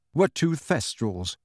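ordinary delivery, nothing marked

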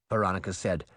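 ordinary delivery

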